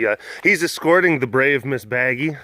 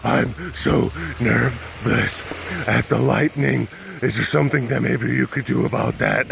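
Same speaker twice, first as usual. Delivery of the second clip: voicing nervous dog. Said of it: "she's like".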